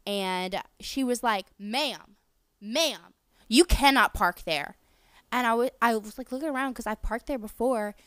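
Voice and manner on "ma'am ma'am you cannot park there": mock yell across a parking lot; retold yell. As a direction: call out sharply, louder on the last words. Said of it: "she's, like, screaming and cursing".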